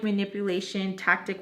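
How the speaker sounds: ordinary talk, nothing marked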